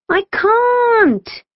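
high, frightened protest; scared wail. A narrator's reading. Kim refuses to climb down, scared wail. Scared girl stuck on ladder.

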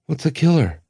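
innocent, he doesn't know the word; young Length. A narrator's reading. Length asks the voice what a killer is, high and puzzled.

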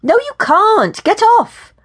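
high, loud, outraged shout; angry refusal. A narrator's reading. Woman pulling her arm from his grasp, angry shout. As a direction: shout him off, voice shooting up.

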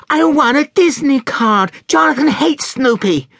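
put-on stubborn child whining out the demand; tantrum child. A narrator's reading. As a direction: pitch it high and insist.

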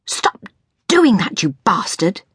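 exasperated yell, dropping on the insult; furious outburst. A narrator's reading. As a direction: rage at a misbehaving appliance. Yelling at the smoking toaster.

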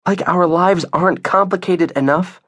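high, sarcastic complaint; exasperated. Acted. Isabel to Nate as the dog complicates life, exasperated. Exasperated wife reproaches husband.

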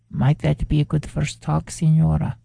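put-on gentle, polite foreign accent with careful English; soft, courteous accent of a traveller asking for work; soft elegant accent. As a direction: speak softly in a graceful foreign accent, each word placed. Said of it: "with a knowing look in his eyes as he continued".

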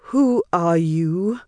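slow, puzzled vampire voice. A narrator's reading. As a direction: ask it slowly, baffled.

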